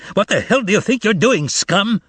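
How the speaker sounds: high, angry bark. A narrator's reading. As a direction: bark it at the thieves. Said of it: "a clerk with a goatee barked out".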